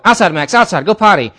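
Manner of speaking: calling the dog. Retold or imitated. Calling the puppy outside.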